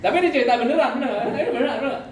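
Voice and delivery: high, loud, laughing through the words; can't stop laughing while insisting it's true; giggling insistence that the story is real. Real, not acted. Cracking up at own joke.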